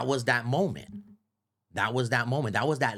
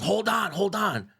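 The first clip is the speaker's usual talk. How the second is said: high, urgent interruption; cutting in; 8 semitones higher than usual. Acted. Radio host stopping the excited caller, cutting in high. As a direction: break in to halt an excited talker. Acting Big Boy cutting him off.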